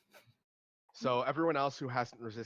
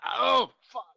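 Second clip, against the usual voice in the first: loud, higher yelp of pain; pained yell; 12 semitones higher, 15 dB louder than usual. Acted. Captive stabbed with a dagger, acted pained yell.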